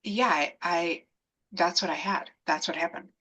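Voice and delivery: soft, halting retold admission; sheepish. Answering the shocked obstetrician in the hallway, sheepishly. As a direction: shrink back and confess it quietly.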